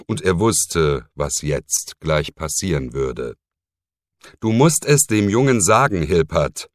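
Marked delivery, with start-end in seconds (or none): 4.4-6.7 pressing, higher and louder; insistent. Acted. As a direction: insist without yielding. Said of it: "in unnachsichtigem Ton". (eindringlich und unnachgiebig)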